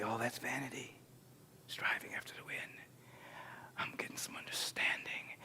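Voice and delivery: soft whispered lament; whispered realization. Retold or imitated. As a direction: whisper it as a private revelation. Whispered quote closing sermon point.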